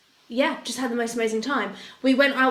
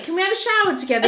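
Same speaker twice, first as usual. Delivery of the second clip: playful asking; 8 semitones higher, 5 dB louder, faster than usual. Retold or imitated. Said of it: "I said to Andrew".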